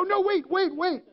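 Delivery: high-pitched, urgent protest, acted out; mock panicked plea. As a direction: try to stop someone hanging up. Begs not to be put on hold.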